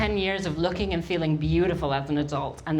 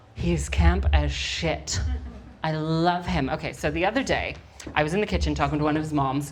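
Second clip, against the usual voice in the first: ordinary talk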